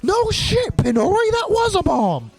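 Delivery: high shrieking cry of panic; startled shout. Acted. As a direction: shout in alarm at the blast. Character startled by blast.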